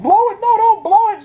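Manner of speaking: flip-flop in a high, hurried panic; panicky Rick. Retold or imitated. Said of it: "He's like".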